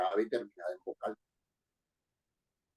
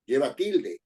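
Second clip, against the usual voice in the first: ordinary talk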